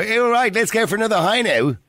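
put-on high-pitched Dublin rugby-boy caricature; mock rugby-club boys. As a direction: parody the lads at the rugby club. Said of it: "the boys in the rugby clubs".

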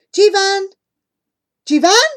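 loud, high calls to wake him; calling a sleeping friend awake. A narrator's reading. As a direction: shout his name to rouse him. Shaking sick friend awake.

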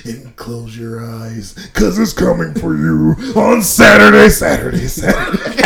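hype announcer. Pretend. Hyped promo announcement.

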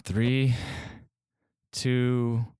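ordinary talk

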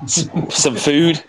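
high, drawn-out mimicking of a British "food"; mock British accent. Teasing a British player over his word food, mock British accent. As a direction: echo it slowly in exaggerated British.

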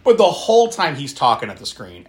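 usual speaking voice

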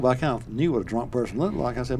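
ordinary delivery

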